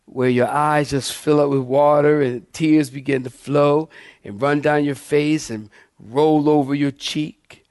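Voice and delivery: usual speaking voice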